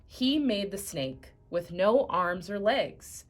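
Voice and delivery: ordinary delivery, nothing marked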